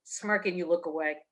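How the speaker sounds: ordinary delivery, nothing marked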